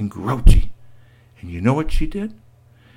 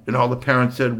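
ordinary delivery

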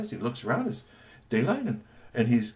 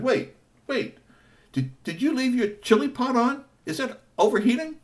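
put-on high, alarmed child voice; a kid suddenly worried; 4 dB louder than usual. Three T asking about the chili pot, high alarmed voice.

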